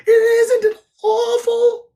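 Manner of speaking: very high, loud, drawn-out squeal; squeaky, stretched cry of dread; frightened Piglet. A narrator's reading. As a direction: wail it slowly as a scared piglet. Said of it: "said Piglet".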